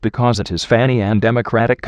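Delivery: ordinary talk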